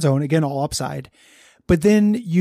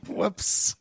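laughing; 8 semitones higher, much slower than usual. Real, not acted. Laughing at own mistake.

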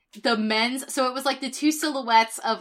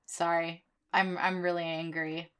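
subdued apology; 4 semitones lower, 7 dB quieter than usual. Real, not acted. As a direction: drop low and soft after an outburst. Subdued apology after screaming.

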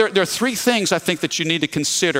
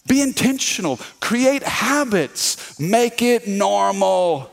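slow emphatic recap; 3 semitones higher, slower than usual. Real, not acted. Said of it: "recaps the three points".

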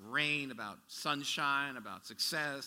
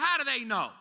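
high, louder disbelieving cry; incredulous question; 8 semitones higher, 7 dB louder than usual. Retold or imitated. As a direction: throw it up in disbelief. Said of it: "I'm like".

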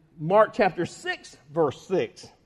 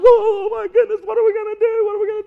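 much higher, louder, wailing panic; panicked disciples; 12 semitones higher, 7 dB louder, faster than usual. Retold or imitated. Disciples in the storm, panicked wail. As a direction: cry out in fright. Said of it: "The disciples".

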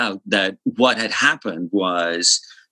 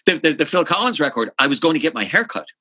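ordinary delivery

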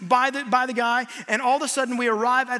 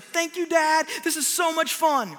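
higher, a lightly voiced excited little girl; happy daughter; 6 semitones higher, slower than usual. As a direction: beam like a thrilled child thanking dad. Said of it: "She's like".

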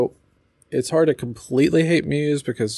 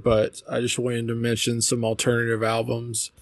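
ordinary talk, nothing marked

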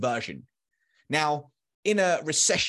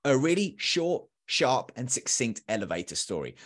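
normal talking voice

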